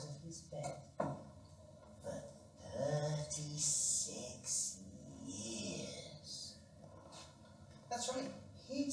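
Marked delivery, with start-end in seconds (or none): 2.8-6.5 thirty-six stretched very slowly; slow emphasis. A narrator's reading. Storyteller to children, stretching thirty-six slowly. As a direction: drag out thirty-six for effect.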